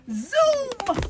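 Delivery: high, loud zoom. A narrator's reading. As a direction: whoosh it out high and fast. Storyteller zooms off.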